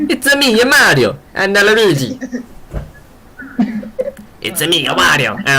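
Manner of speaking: high, bouncy Italian-cartoon "It's a me"; bad Mario impression. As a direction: ham up a terrible Mario voice. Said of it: "really bad impersonations of, of Mario".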